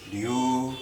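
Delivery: normal talking voice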